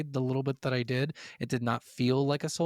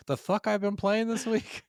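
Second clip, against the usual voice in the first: higher-pitched echo of the co-host; imitating co-host's phrasing; 8 semitones higher than usual. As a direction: parrot the co-host's self-question, pitched up. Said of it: "said it just like that".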